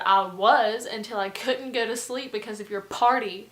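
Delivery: loud, pointed blame; hateful retort. Retold or imitated. Roommate blaming a partygoer for no sleep, loud hateful retort. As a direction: throw the blame back loudly. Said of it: "just being so hateful to this guy".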